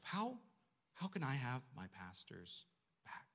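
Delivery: ordinary delivery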